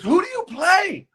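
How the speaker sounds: high, eager starstruck voice; older man asking the actor, thrilled; excited question. Retold or imitated. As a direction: ask it like a fan who can't wait. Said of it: "he goes".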